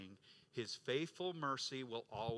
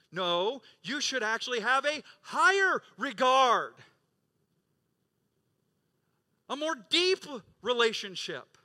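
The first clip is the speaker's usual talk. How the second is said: loud, high, driving sermon climax; full-force preaching; 8 semitones higher, 10 dB louder than usual. Acted. Pastor thundering at his congregation, loud and high. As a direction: preach it out to the whole church.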